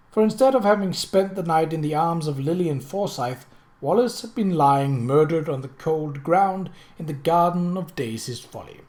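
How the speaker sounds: ordinary delivery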